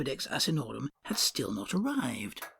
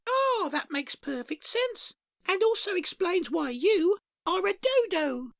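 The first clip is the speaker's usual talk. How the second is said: high, slow, pleased beaver; a beaver accepting a compliment; 14 semitones higher than usual. Acted. Beaver replies to the customer, high and slow.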